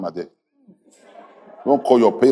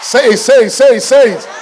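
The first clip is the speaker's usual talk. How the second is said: high, loud rapid-fire urging; urging the congregation; 11 semitones higher, 6 dB louder than usual. Real, not acted. Preacher pushes congregation to repeat blessing, loud and high. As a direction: whip the crowd into declaring it.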